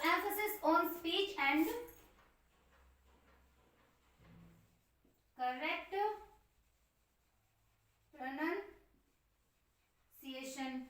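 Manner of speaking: each word spaced out slowly and softly while writing; slow dictation. Shown as a demonstration. Teacher dictating notes to the class, slow and soft. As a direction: dictate it word by word for students to copy. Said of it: "जोर दिया जाता है".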